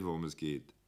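ordinary delivery, nothing marked

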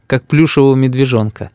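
ordinary delivery, nothing marked

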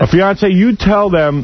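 high-pitched, slow retold screaming; mock scream. Sister screaming at her brother on air, high-pitched mock scream. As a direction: shriek her words up high like she's losing it. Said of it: "she's screaming at him".

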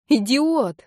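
high, loud outraged cry; piercing scream. A narrator's reading. Furious heroine to the guy she just saved, piercing shout. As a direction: yell the insult at him. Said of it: "закричала я пронзительно".

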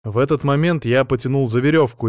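ordinary delivery

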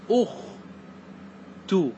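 أخ تو split as "ukh... tuu", long pause between; damma, syllable by syllable. Shown as a demonstration. Teacher demonstrating damma to students, أخ تو in separate syllables. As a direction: sound out أخ, pause, then تو, both with u. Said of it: "مثلًا ضم تقول".

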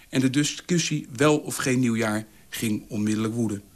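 usual speaking voice

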